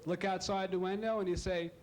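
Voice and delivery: usual speaking voice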